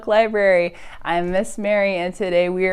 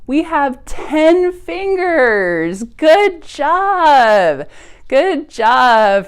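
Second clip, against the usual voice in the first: slow, higher and louder cheer; excited praise; 6 semitones higher, 5 dB louder, much slower than usual. Acted. Librarian praises preschoolers for counting, excited. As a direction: celebrate the kids' counting.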